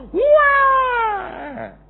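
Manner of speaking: put-on high child wail; mock a child's screaming tantrum; anak merengek. Anak menangis. (tangisan anak yang dibuat-buat, melengking tinggi)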